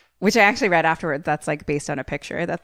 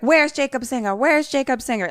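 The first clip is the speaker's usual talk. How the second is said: urgent demand; 7 semitones higher, 4 dB louder than usual. Retold or imitated. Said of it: "comes in guns a-blazing".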